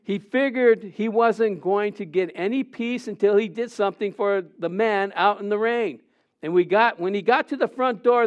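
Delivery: ordinary delivery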